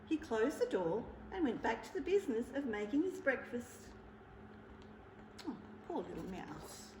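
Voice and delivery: ordinary delivery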